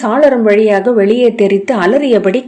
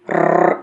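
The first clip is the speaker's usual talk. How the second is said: a deep growling rrr like a great ape; 3 dB quieter, much slower than usual. A narrator's reading. The great ape answers the startled jester with a deep growl. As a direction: growl low like a monkey in the dark. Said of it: "என்றது".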